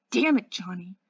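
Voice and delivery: loud, anguished outburst; begging. A narrator's reading. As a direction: burst out loudly, pleading with him. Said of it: "he begged".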